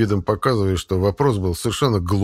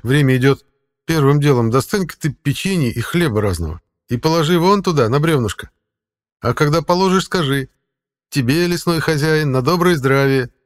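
higher, louder voice for the talking cat; 6 semitones higher, 4 dB louder than usual. Acted. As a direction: play the sly cat guide. Cat character voice.

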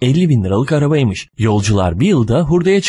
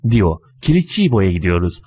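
plain reading voice

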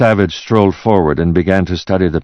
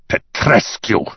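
name said with contempt, higher; spat-out name; 5 semitones higher, slower than usual. A narrator's reading. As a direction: spit the name out. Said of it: "spitting out the name".

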